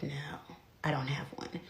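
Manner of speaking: very soft, low, near-whispered reply; sheepish admission. Retold or imitated. Student admits to the cop she has no license, meek and hushed. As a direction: admit it meekly, voice almost gone.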